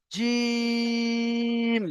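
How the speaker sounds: জিম with the vowel stretched very long and slow; drawn-out letter name. Shown as a demonstration. Teacher showing students how long to stretch জিম. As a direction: hold the ি in জিম like a long chanted note. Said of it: "এখানেও একালি পরিমাণ টান দিয়ে পড়তে হবে".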